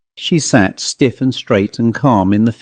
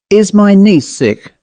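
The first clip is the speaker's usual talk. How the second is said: slow, higher, pressing question; quick suspicion; 8 semitones higher, 3 dB louder than usual. A narrator's reading. Rebecca suspects something wrong with her niece, suspicious. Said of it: "asked Rebecca with quick suspicion".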